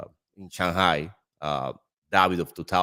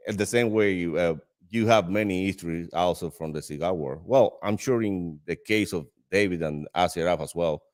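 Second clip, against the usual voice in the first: ordinary talk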